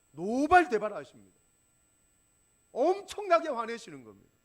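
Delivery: 노발대발을 크게 터뜨리듯; 성도들에게 외치듯 힘껏; loud preaching. Acted. Preacher describing Jesus' fury. (크게 외치는 설교)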